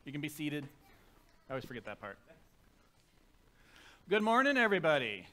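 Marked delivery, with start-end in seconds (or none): 4.1-5.3 loud greeting. Real, not acted. Pastor greeting the congregation.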